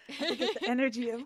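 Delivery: ordinary delivery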